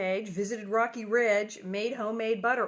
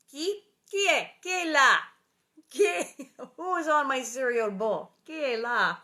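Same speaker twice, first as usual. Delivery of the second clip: loud, high mock questioning in French; playful French calling; 4 semitones higher, 8 dB louder than usual. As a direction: call out "who's there?" with amusement. Playful French question about cereal bowl.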